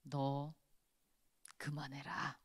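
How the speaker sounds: 낮고 조용하게, 숨소리로 경고하는 하나님; 조용히 단호하게 그만두라고 속삭이기; 무섭게 속삭임. Retold or imitated. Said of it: "정말 무섭게". (불평을 멈추라는 하나님, 낮은 속삭임)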